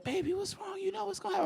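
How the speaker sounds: high, soft soothing voice; comforting girlfriend. Retold or imitated. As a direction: coo like a gentle girlfriend. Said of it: "She's like".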